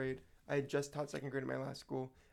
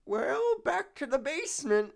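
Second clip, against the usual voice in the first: put-on old man voice; 12 semitones higher, 8 dB louder, slower than usual. Voicing old man character.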